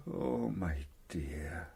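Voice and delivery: hushed, heartbroken tenderness; husband answering his wife's whisper tenderly. Acted.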